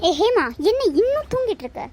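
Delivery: high, swooping call of complaint; reproachful wake-up call. Acted. Husband rousing sleeping wife, high-pitched reproach. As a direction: call out in disbelief to rouse a sleeper.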